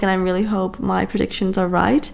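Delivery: normal talking voice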